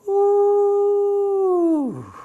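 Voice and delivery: long high-pitched "ooh", loud and sustained; drawn-out high hoot of effort, acted out; imitated long "ooh". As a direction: imitate a big guy's loud, high "oooh", dropping at the end. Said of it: "And he goes".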